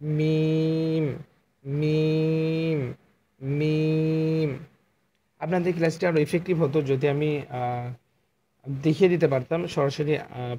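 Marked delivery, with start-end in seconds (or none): 0.0-4.5 মিম held long, dry lips pressed together; slow, sustained মিম; Arabic letter demo. Shown as a demonstration. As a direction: hum the মিম long and steady. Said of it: "শুকনা অংশ একসাথে লাগিয়ে রেখে মিম হরফটি".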